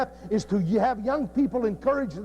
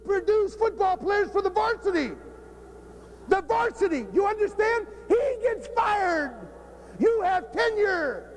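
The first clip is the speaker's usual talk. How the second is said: heated rant; 7 semitones higher than usual. Real, not acted. Coach ranting at the audience.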